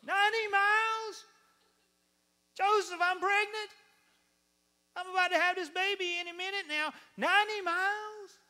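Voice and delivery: shrill, loud pregnant wife; outraged disbelief. Acted. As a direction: shriek in hammed-up horror at the husband. Pregnant wife protesting in acted quarrel.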